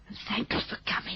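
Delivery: soft unvoiced whisper of thanks. Quiet thanks to doctor.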